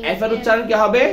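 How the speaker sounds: usual speaking voice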